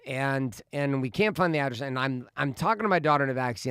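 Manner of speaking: normal talking voice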